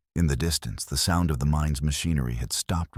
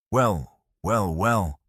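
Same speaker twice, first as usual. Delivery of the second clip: calling out; 4 semitones higher, much slower than usual. A narrator's reading. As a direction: call it out across the distance. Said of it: "Silas called out".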